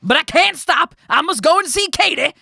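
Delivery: loud, high excited shout; shouting miller. A narrator's reading. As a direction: yell it as if rushing off. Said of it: "shouted the miller".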